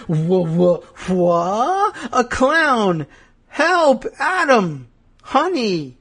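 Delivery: high mock-panicked stammer into cries for help; panicked wife. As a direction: call out in hammed-up alarm, pitch climbing. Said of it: "and then she's like".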